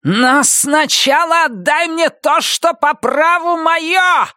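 very high, drawn-out shriek; piercing inhuman wail. A narrator's reading. Роза Марена demanding what is hers, inhuman shrieking. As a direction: shriek your demand like something no longer human. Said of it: "пронзительно завопила Роза Марена совершенно нечеловеческим голосом".

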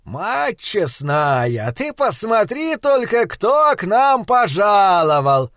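slow, high delighted exclamation; exclaiming. Acted. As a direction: Flanagan exclaims in delight at Will's arrival. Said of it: "воскликнул Фланаган".

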